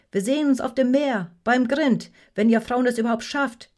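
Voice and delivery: high, loud taunt across boats; loud jeering. A narrator's reading. Kittel mocking the women's crew, loud jeer. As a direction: jeer at them loudly. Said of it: "höhnte Kittel lautstark".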